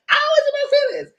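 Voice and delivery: high squeal just out of laughter; high-pitched amused confession; amused outburst. Real, not acted. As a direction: blurt it high, fresh from cackling. Admits it after laughing.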